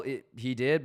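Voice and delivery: usual speaking voice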